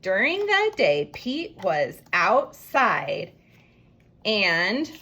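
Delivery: slow, stretched reading, "outside" drawn out; slow reread. Shown as a demonstration. Teacher rereads the sentence slowly for the class. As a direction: read it back word by word. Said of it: "I'm gonna reread".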